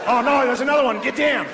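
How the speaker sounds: high-pitched mock Australian alarm; cartoonish Australian office worker panicking; panicked Australian. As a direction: cry out in a hammed-up Australian accent. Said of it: "an Australian guy in the office on nine eleven".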